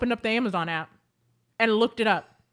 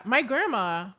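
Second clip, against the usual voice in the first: ordinary talk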